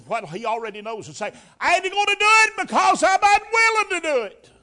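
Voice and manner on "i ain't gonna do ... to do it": loud refusal. Retold or imitated. Preacher voicing honest refusal.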